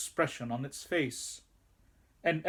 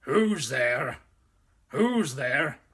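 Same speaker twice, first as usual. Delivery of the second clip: louder growled challenge from the doorway; growling wolf; 5 dB louder, slower than usual. A narrator's reading. Wolf at the door growling at a stranger, growled challenge. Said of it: "said in a growling voice".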